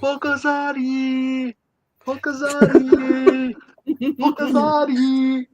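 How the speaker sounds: high, drawn-out mock calling; yelling. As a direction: holler the name as if across mountains. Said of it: "they're yelling".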